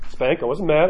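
usual speaking voice

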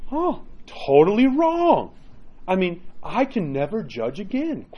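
high, drawn-out amazement; astonished realization. Acted. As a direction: stretch it out, stunned at being wrong. Priest acts out shock after confession.

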